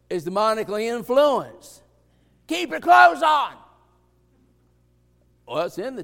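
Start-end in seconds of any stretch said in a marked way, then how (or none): 2.5-3.5 high, loud mock command; a shouted rule from the pulpit; stern shout. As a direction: bark it at the room like a warning.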